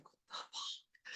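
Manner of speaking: breathless, stunned whisper; shocked whisper. Pretend. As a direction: whisper it in disbelief. Stunned by the tidied medicine cabinet.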